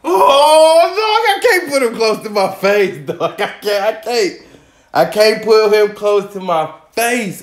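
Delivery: high scream breaking into laughter; shriek, then laugh it off; scream into giggles. Real, not acted. Lobster near face, scream laughing.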